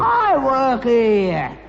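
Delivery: slow, loud, stressed protest; indignant insistence. Acted. Father insisting to his son he works too. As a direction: drag each word out indignantly.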